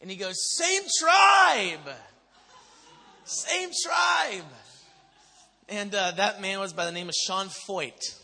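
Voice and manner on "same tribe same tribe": high, loud rallying yell; imitated exuberant shout. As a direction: holler it joyfully like an excited worshipper. Imitates the worship leader's exuberant yell.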